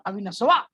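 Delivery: "अविनशवा" with an s and added -वा ending; Bihari-accented "अविनशवा"; Bihari pronunciation. Shown as a demonstration. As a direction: stretch the name into Bihari "अविनशवा". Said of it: "कहीं बोले अविनशवा".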